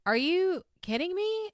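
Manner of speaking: high-pitched disbelief; incredulous. Real, not acted. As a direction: react in disbelief, pitch jumping up. Host balks at a quoted insult.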